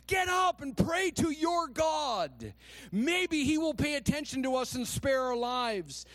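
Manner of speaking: loud, very high urgent command; shouting. A narrator's reading. Captain ordering Jonah to pray, shouted. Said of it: "he shouted".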